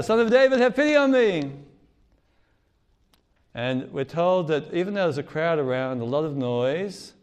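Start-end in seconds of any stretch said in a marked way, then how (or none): 0.0-1.5 high, loud desperate plea; shouts louder. Retold or imitated. Beggar shouting over the crowd to Jesus, loud pleading cry. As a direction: call out to be heard over a crowd. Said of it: "he shouts out even louder".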